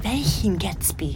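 hushed, sleepy whisper; whispered late-night question. Retold or imitated. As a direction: breathe the question half asleep. Daisy's night question retold.

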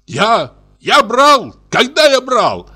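outraged, squealing denial; indignant shriek rising from the first word; high squealing shout. A narrator's reading. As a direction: yell it in a very high, screechy voice. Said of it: "закричал высоким, визжащим голосом Кузьма".

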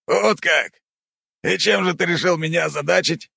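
high smirking uncle voice. Acted. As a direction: smirk through the question. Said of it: "ухмыльнулся он".

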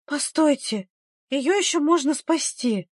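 high, pleading voice through tears; tearful murmur. A narrator's reading. Midwife on the ground begging villagers, tearful murmur. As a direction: plead desperately, a touch tearful. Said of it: "бормотала".

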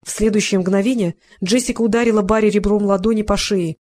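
usual speaking voice

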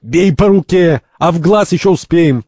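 high, loud, stretched command; urgent shout. Acted. First urges the others to strike, shouting. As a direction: egg on the beating. Urging blows in fight.

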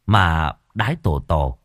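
ordinary delivery, nothing marked

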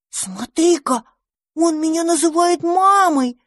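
light, delighted disbelief; dandelion amazed that the hatchling calls him mama; surprised. Acted. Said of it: "удивился одуванчик".

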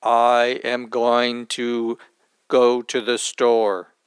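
low, slow, warped robotic audio; distorted voice. Shown as a demonstration. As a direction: sound like a broken recording. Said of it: "This would be an example of a distorted voice".

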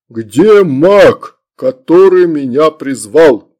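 loud demon voice, drawn-out ending; demanding demon. A narrator's reading. Demon demands his summoner, imperious and loud.

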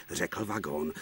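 ordinary delivery

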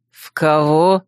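a startled, genuinely puzzled question; surprised. Acted. Sword-wielding rival startled by the girl's claim, surprised. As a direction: caught off guard by the word. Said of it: "Рольер так удивился".

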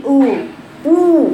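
«أو» بنبرة أعلى وضم محكم; ضم شفتيك ومد «أو»; ضمة تامة. Shown as a demonstration. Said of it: "الضمة دلوقتي". («أو» ممتلئة مضمومة الشفتين)

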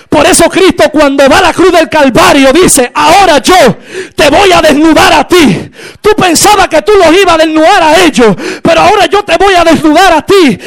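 emphatic, strained pulpit declaration; full-force preaching. Acted. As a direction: thunder the threat at the enemy.

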